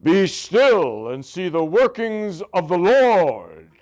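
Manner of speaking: slow, booming biblical Moses voice, deliberately grand; Charlton Heston impression. Lecturer imitates the film Moses commanding the people, slow and booming. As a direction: intone it like an epic movie prophet, very slow. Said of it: "this is my best Charlton Heston imitation".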